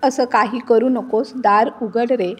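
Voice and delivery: plain reading voice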